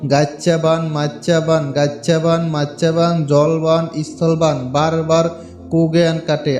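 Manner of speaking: slow, solemn chant of a spell, low and loud; mantra recitation. Shown as a demonstration. Vlogger reciting the mantra for viewers, slow incantation chant. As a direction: intone each line like an incantation. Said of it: "মন্ত্রটা আমি আপনাদেরকে উচ্চারণ করে শোনাচ্ছি".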